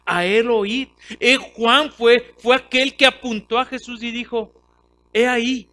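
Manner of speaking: full-force preaching. Acted. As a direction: drive the point home to the congregation. Preaching about John the Baptist.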